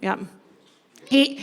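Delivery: normal talking voice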